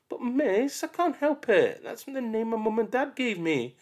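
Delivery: put-on protesting child. Mark protests his name to the teacher, whining. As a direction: whine in protest.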